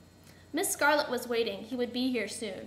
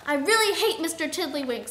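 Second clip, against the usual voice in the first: louder, emphatic outburst; punchy, raised-volume complaint; emphatic dislike; 4 semitones higher, 6 dB louder than usual. A narrator's reading. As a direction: declare the hatred forcefully. Angry declaration opens the scene.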